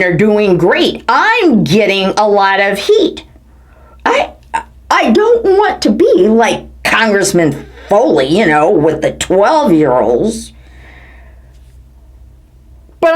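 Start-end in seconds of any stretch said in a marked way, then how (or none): none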